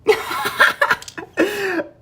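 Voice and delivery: high burst of loud laughter. Real, not acted.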